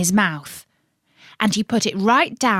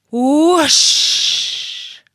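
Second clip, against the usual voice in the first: whoosh sound; 7 semitones higher, 5 dB louder than usual. Acted. Witch flies over pond.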